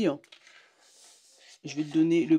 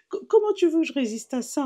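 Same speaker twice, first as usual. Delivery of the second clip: high, louder cooing voice; cooing at cat; 10 semitones higher, 4 dB louder than usual. Real, not acted. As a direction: gush over something adorable.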